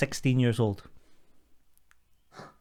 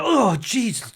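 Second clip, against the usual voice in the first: higher startled yelp; startled exclaim; 9 semitones higher, slower than usual. Retold or imitated. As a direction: jump out of your skin and blurt it. Boss startled in dark stock room.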